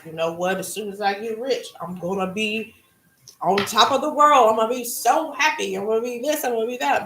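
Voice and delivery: hammed-up giddy daydream of getting rich; imitating someone sure that getting rich will make them happy; mock excited dreamer.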